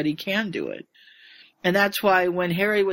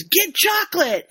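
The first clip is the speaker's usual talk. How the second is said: high, loud urgent shout; sudden cry to grab the chocolate; retold yell; 10 semitones higher, 5 dB louder than usual. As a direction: call it out in a hurry. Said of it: "And Harry yells".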